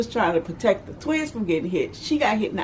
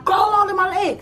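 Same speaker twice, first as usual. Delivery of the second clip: mock stern order; 13 semitones higher than usual. Mama to her little child in the crowd, urgent and stern. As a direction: bark it down at the kid.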